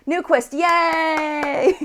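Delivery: slow, high, laughing "Newquist, yay!"; stretched happy yay; laughing cheer. Real, not acted. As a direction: celebrate with a laugh. Gets the name right, laughs.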